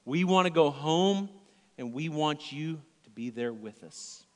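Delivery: ordinary talk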